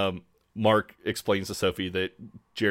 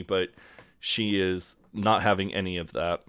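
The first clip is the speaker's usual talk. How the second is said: ordinary talk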